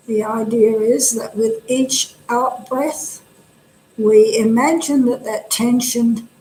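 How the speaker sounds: calm, unhurried instruction with pauses between phrases; guided meditation. Real, not acted. Meditation teacher helping listeners settle the body, calm guidance. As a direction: settle the listeners gently into the practice.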